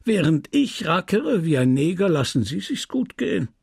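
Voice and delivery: high-pitched, aggrieved accusation; indignant, swooping taunt; sneering reproach. A narrator's reading. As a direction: throw the blame at a debtor. Merchant reproaches her.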